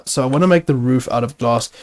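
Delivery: ordinary delivery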